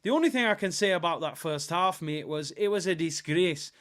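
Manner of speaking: amateur attempt at a Scottish accent. Shown as a demonstration. Streamer imitates disappointed Scotland fan for chat, Scottish accent. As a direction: put on a Scot's accent, mock-aggrieved. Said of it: "I'll try and do a Scottish accent".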